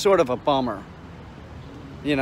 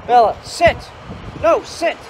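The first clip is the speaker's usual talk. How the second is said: sharp dog command; 10 semitones higher than usual. Real, not acted. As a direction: raise the voice, high and firm, at the dog.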